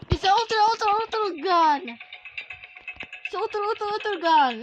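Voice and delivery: high, loud hyped-up announcement; excited boast. Real, not acted. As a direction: shout proudly about a super weapon. Boasting of gun to Grandma.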